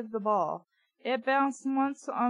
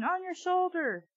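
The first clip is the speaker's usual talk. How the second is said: mock scream; 8 semitones higher, 4 dB quieter than usual. A narrator's reading. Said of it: "I screamed".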